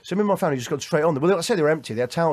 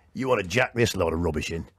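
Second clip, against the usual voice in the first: put-on much lower, gruff-free old comic voice, dropped low; imitating Paul Shane; 11 semitones lower than usual. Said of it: "he's gone".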